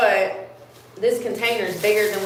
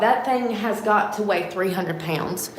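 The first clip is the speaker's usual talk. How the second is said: normal talking voice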